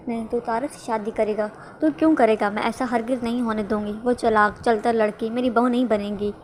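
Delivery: usual speaking voice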